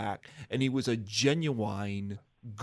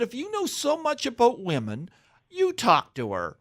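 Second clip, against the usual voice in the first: high retort, landing louder; imitated exasperated suitor; 12 semitones higher, 5 dB louder than usual. Suitor challenges buddy hiding in bushes, mock high retort. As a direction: throw it back at your buddy, starting high. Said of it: "He said".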